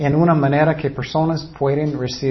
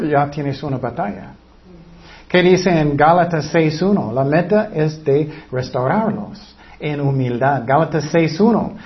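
normal talking voice

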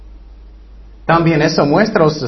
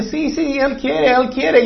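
put-on much higher childlike voice, eager; pleading children; 8 semitones higher, slower than usual. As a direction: beg like a little kid who wants in. Children pleading to see Jesus.

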